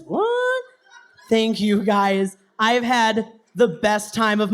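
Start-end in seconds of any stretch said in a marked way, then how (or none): 0.1-0.6 mock surprise.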